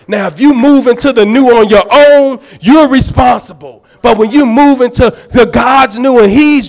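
loud, driving preacher's call; full-force preaching. Real, not acted. Preacher urging the saints to move into the new, loud. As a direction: preach it out with power.

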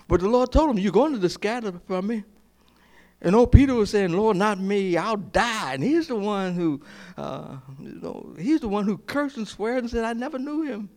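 high-pitched preaching build; preacher retelling Peter's denial, high and fervent; rising sermon fervor. Real, not acted. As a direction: lift the voice as the story peaks. Preaching at full force.

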